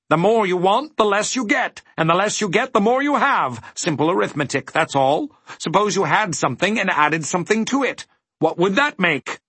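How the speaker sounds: high-pitched, brisk teacher figure; explaining dodecahedron. A narrator's reading. Dodecahedron teaching Milo arithmetic, assured. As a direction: quiz in a very high character voice.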